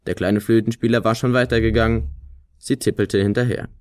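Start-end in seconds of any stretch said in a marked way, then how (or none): none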